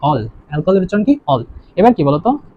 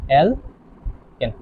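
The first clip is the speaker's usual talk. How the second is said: Alkane very slow and softer, syllables split by a pause; drawn-out dictation; 4 semitones lower, 7 dB quieter, much slower than usual. Shown as a demonstration. Teacher dictating the first compound, alkane slowly in pieces. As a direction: separate the two syllables of alkane.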